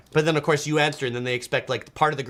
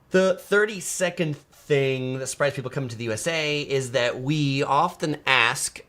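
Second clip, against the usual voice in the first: normal talking voice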